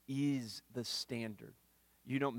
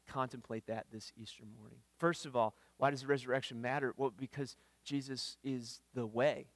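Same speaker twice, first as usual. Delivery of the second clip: normal talking voice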